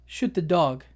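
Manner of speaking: ordinary talk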